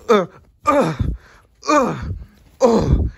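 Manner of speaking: hammed-up hurt cries after a beating; mock crying. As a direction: whimper as if being beaten. Beaten up in the skit.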